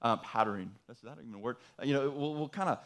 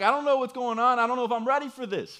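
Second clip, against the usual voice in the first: inner panic; 9 semitones higher, 6 dB louder than usual. Retold or imitated. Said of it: "freaking out internally".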